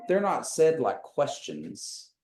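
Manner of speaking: ordinary talk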